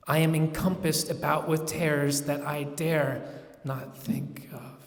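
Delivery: ordinary delivery, nothing marked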